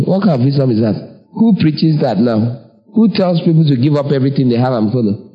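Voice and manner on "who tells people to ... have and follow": normal talking voice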